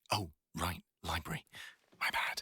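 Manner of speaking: drops to a whisper mid-apology; sudden whisper. Real, not acted. Catching himself in the library and whispering an apology. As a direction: hush it, remembering the library. Remembers they are in library.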